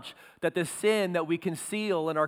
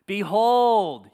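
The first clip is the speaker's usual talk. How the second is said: loud drawn-out Behold; loud call; 3 semitones higher, 7 dB louder than usual. Acted. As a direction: call Behold like pounding on a door. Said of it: "bang on the door hard".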